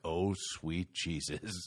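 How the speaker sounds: low, slow, hushed horror; drawn-out quiet disbelief; stunned dismay. Acted. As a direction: let it sink out slowly, appalled. Father sees who broke in.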